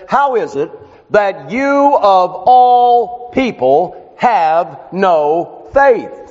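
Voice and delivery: slow, weighty rebuke; emphatic rebuke. Acted. Jesus rebukes the twelve disciples, emphatic and slow. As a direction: press each word of the rebuke home slowly. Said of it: "it is emphatic".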